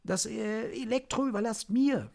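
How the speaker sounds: eager delight at the challenge; unusually joyful. Acted. Ali claims the electronics monster for himself, gleeful. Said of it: "mit einer für ihn ungewöhnlichen Freude".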